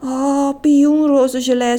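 swooning sigh over a sweet word; dreamy sigh. A narrator's reading. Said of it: "zuchtte mevrouw de dief".

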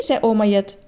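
ordinary delivery, nothing marked

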